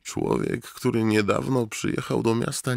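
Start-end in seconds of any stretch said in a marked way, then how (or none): none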